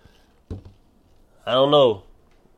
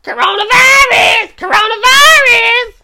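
squealed sing-song shriek, far higher; 8 dB louder than usual. Retold or imitated. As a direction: shriek it like the viral clip.

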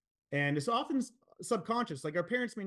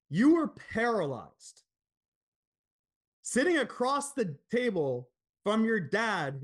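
slow, higher, each phrase laid down with weight; slow deliberate point; 3 semitones higher, 3 dB louder, slower than usual. Retold or imitated. Business coach laying out the hard truth to his client, slow and deliberate. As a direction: spell it out slowly so it lands.

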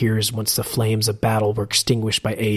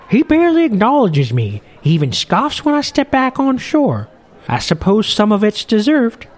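put-on much higher, louder old sailor voice; a chatty old sea captain, pitched way up; old fisherman; 10 semitones higher, 4 dB louder than usual. Old captain grumbling to Morwell at the bar, in a high loud old-man voice.

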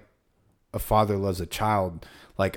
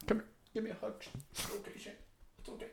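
much softer, higher, whispered comfort; mock soothing; 11 semitones higher, 19 dB quieter, much faster than usual. Mocking the teacher hugging Shane, soft whispered mock comfort. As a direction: coo it like a fake consoler.

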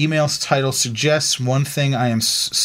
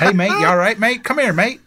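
put-on Australian accent, high and laughing; hammed-up Australian mate greeting; mock Australian; 8 semitones higher, 3 dB louder than usual. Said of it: "they all say mate to each other".

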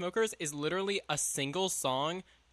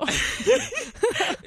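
loud high laugh; 7 dB louder than usual. Real, not acted. Big laugh at the joke.

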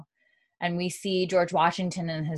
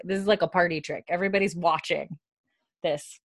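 usual speaking voice

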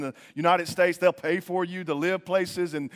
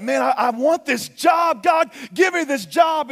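loud, high, drawn-out begging; pleading demand; 8 semitones higher, 6 dB louder, slower than usual. Acted. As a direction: shout it like an impatient plea to God. Acting out a desperate demand to God.

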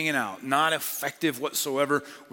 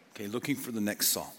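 soft repeat; 7 semitones lower, 8 dB quieter than usual. Shown as a demonstration. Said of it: "I'm just gonna call it this".

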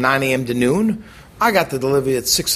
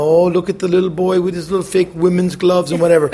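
higher, louder teasing voice, lightly put on; mocking coworkers; 4 semitones higher, 5 dB louder than usual. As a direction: mimic the cooks jeering at him. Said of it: "they were all making fun of me".

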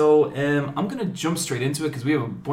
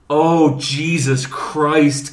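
loud, drawn-out dismayed groan of words; big retold cry of dismay; exasperated outburst; 3 semitones higher, 10 dB louder, much slower than usual. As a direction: stretch the oath out, loudly. Retold dismay at no free seats.

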